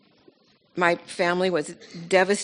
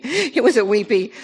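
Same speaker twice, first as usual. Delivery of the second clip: laughing through the words, louder and higher; 5 semitones higher, 7 dB louder than usual. Real, not acted. Speaking about her wedding, laughing through "it was a weepy".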